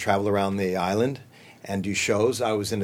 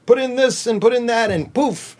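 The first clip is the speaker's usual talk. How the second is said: put-on high, sing-song magician voice for kids; magic show voice; 11 semitones higher than usual. Kids' theater magician casting a spell, high sing-song. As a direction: ham it up like a stage magician.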